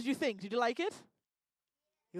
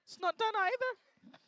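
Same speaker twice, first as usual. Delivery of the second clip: fussy high voice; 8 semitones higher than usual. Acted. Stammering, high strict voice.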